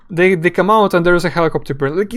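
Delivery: usual speaking voice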